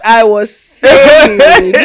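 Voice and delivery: slow, loud words breaking into laughter; laughing. Real, not acted. Host resuming her point after star-sign teasing, laughing. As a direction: drag it out loudly, then giggle.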